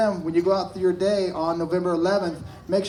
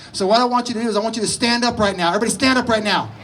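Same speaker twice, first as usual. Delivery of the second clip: drill instructor; 4 semitones higher than usual. Pretend. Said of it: "take part in the first day of Marine Corps basic training".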